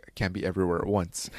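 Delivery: lightly voiced low gravelly Batman; a hint of brooding Batman narration; soft brooding hero line; Batman voiceover. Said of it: "in his little voiceover".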